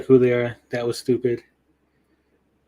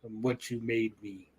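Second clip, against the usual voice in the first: low, much softer mock-menacing line; brooding Vader reply; 3 semitones lower, 10 dB quieter than usual. Mocking Vader answering Obi-Wan, low and soft. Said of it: "And he's like".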